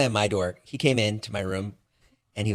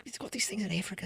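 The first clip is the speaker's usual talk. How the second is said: quiet, high mock British voice slipping toward Australian; lightly mimicked British accent, high and soft; attempted British accent; 10 semitones higher, 11 dB quieter than usual. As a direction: do a botched British neighbor. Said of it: "he's British".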